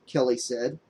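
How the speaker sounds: usual speaking voice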